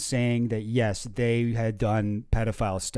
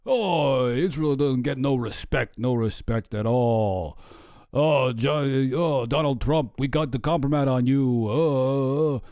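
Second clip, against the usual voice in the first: slow, drawn-out New York comic voice; hammed-up New York accent, stretched vowels; Rodney Dangerfield impression; slower than usual. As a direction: groan it out like a put-upon stand-up comic. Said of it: "I gave him kind of a Rodney Dangerfield".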